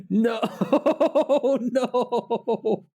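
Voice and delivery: high-pitched laughing "no"; cracking up helplessly while saying it; words drawn out through real laughter; laughing hard. Real, not acted. Laughing at own edited picture.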